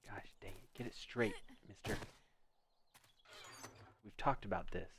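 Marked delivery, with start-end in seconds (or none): 0.1-0.7 a soft whispered "Gosh dang it"; quiet frustrated whisper; whispered curse. Real, not acted. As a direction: mutter it under your breath. Frustrated mutter.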